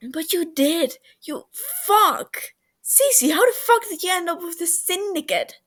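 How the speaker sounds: overwhelmed anger at a lost friend; tearful shout. A narrator's reading. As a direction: shout it out while tearing up.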